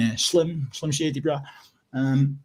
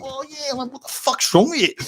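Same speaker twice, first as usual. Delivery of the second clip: much higher, hammed-up cheering; mock fan; 12 semitones higher than usual. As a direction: cheer like a starstruck supporter.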